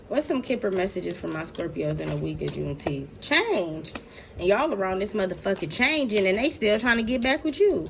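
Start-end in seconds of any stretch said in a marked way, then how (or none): none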